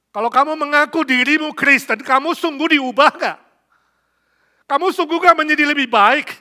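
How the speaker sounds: very loud, high exhortation; full-force preaching. Real, not acted. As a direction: challenge the congregation with ringing questions. (khotbah berapi-api, suara lantang dan tinggi)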